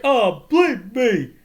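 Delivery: high, loud and drawn out; mock exasperated outburst. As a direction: imitate a comic cry of frustration. Retold outburst after missed dunk.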